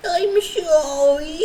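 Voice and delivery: put-on high, loud, slow babyish "I'm sorry"; hammed-up baby-voice sorry; cutesy apology. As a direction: whine it like a pouting child.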